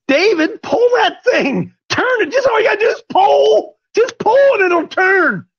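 high, pleading, straining voice, rising to a shout; God urging David. Retold or imitated. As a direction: beg him to just pull, voice cracking. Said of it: "He's just trying to say".

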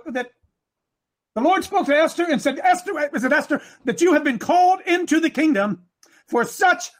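high-pitched, driving sermon cadence; impassioned preaching. Real, not acted. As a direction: build the charge name by name, urgently. Preaches at full force.

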